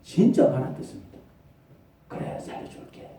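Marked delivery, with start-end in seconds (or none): 2.1-3.1 whispered divine no; whispered warning. Acted. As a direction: hush it, firmly. God's voice whispering. (속삭이는 하나님의 경고)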